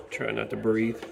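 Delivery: usual speaking voice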